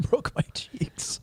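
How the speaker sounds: breathy wheeze of laughter; laughing. Real, not acted. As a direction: gasp it out while cracking up. Laughing repeat of friend's phrase.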